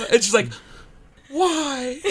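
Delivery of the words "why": put-on high British-accented why; a hammed-up British accent. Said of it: "In a British accent".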